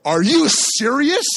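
high, loud, drawn-out disbelief; outraged dad. Retold or imitated. Dad hearing his kid was hit, outraged. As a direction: explode slowly in indignant disbelief.

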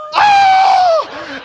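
very high, loud drawn-out shriek; hammed-up scream of alarm; panicked wail. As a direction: wail in mock terror.

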